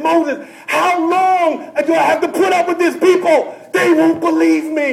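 loud, high shout with laughter; laughing, shouted complaint; exasperated God. Acted.

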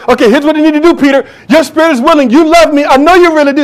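loud fervent Jesus; Jesus voiced. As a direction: speak as Jesus to Peter. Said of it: "And Jesus says".

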